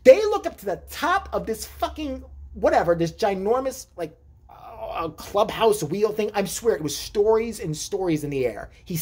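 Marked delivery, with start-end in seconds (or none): none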